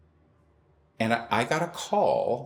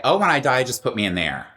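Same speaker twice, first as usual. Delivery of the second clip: Jim joking; 5 semitones higher, 7 dB louder than usual. Retold or imitated. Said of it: "he used to say".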